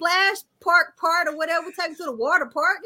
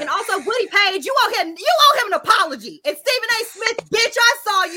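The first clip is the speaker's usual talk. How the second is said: high-pitched, comic indignation; scolding someone who owes an apology; indignant call-out; 7 semitones higher than usual. Real, not acted. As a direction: demand it with raised pitch. Calling out commentators for an apology.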